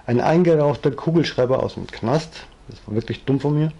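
usual speaking voice

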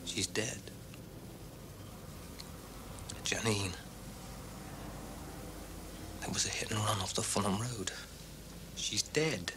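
low, near-whispered shock; hushed bad news. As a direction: break the death quietly. Breaking news of a death.

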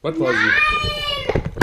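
very high, slow, drawn out; squeaky doll voice. Pretend. Playing with dolls, doll voice.

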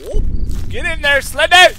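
loud playful taunt at the monster; taunting yell. Real, not acted. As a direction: egg the monster on.

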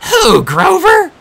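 very high, loud, slow; stretching out Who in shock; outraged disbelief. A narrator's reading. Clarisse's outraged question.

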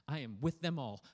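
normal talking voice

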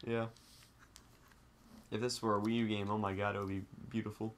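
ordinary talk, nothing marked

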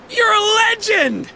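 high, excited fan outburst; starstruck. Acted. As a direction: burst out in awe of your hero. Starstruck officer meets his hero.

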